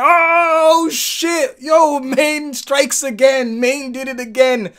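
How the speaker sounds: loud mock hype of the friends; hyped friends. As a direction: cheer like your boys going crazy. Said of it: "They're like".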